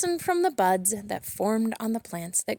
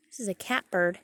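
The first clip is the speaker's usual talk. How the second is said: plain reading voice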